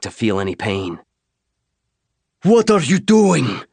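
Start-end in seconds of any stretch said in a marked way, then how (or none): 2.4-3.6 put-on high, loud, slow alien shout with a heavy accent; a towering alien yelling in accented English; alien warrior shout. Alien warrior to the human who fired inside: loud, high, slow accented shout. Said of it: "the Sangheili shouted in heavily accented English".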